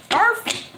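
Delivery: high yappy mock bark; dog bark. Reader hinting the boyfriend is a dog, mock bark. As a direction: yip it like a little dog. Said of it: "He could be not so".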